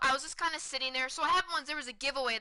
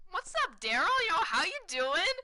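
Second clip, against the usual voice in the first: higher-pitched, upbeat hail; retold friendly greeting; 6 semitones higher than usual. Fan greeting Daryl Boston by the dugout, retold upbeat hail. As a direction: re-enact calling out a greeting, pitched up.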